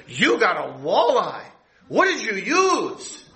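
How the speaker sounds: high, slow, astonished question with a laugh; disbelieving fisherman, pitch jumping up; amazed fisherman. Retold or imitated. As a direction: play the stunned old fisherman.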